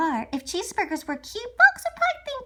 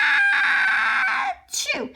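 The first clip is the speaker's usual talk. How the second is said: big high "Ah, chew" sneeze; loud sneeze; 6 dB louder than usual. Retold or imitated. Wolf sneezing at pig's house, loud high sneeze. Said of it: "I was like".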